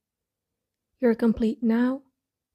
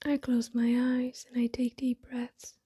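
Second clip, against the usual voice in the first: soft, breathy meditation voice with long pauses; hushed, unhurried affirmations spoken as if eyes closed; guided meditation; 4 dB quieter than usual. Acted. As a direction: settle inward, softly, phrase by phrase.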